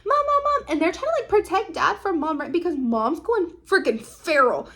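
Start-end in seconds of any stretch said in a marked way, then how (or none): 0.0-0.7 high-pitched imitation of kids; rapid-fire mock cries for mom; alarmed children. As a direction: plead like kids stopping their mother. Children pleading with raging mom.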